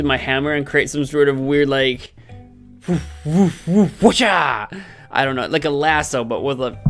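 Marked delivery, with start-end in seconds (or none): 2.9-4.8 swoosh noise. Retold or imitated. Imitating a lasso swing.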